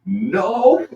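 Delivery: high, loud retold yell; mad protest. Singer rushing out to overrule sound guy, mad yell. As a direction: cry out in mock outrage, half laughing. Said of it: "see you get so mad".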